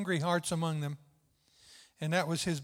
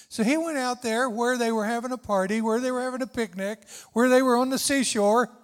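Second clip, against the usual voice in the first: rising louder with each phrase; building preaching cry; 5 semitones higher, 6 dB louder than usual. Real, not acted. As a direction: build the sermon line up to a call.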